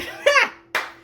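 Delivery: airy laugh at her own mistake; short unvoiced giggle; breathy high laugh. Real, not acted.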